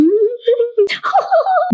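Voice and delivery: put-on little-girl giggle, very high; high-pitched giggle. Reading the stage direction aloud, acted high giggle. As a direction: giggle like a small child.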